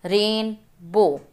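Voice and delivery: ordinary delivery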